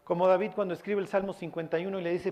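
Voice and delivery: ordinary delivery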